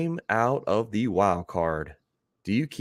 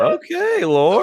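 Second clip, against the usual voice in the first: much higher, louder teasing call; excited; 6 dB louder, slower than usual. Real, not acted. Host teasing a viewer over the jersey, gleeful call. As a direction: call out the viewer's name with glee.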